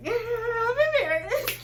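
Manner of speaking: high, loud and slow through laughter; laughing. Retold or imitated. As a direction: laugh it out, embarrassed. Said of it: "I laughed too because I was like".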